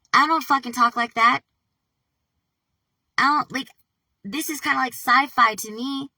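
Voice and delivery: loud, high, rattled protest; freaked out. Real, not acted. Disowning the words in the email, freaked out. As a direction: protest loudly in alarmed disbelief.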